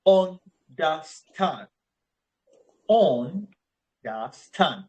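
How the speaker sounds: understand drawn out very slowly, stand stressed; stress on last syllable. Shown as a demonstration. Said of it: "will have I behind it".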